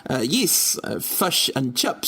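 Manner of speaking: put-on New Zealand accent, slowly; clipped-vowel Kiwi accent demo. Shown as a demonstration. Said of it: "Can you do a New Zealand accent?".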